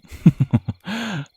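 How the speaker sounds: a high, satisfied chuckle; triumphant little giggle; gleeful high laugh. Acted. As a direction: laugh at winning the deal. Pleased at the captive's agreement.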